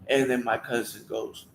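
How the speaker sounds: ordinary talk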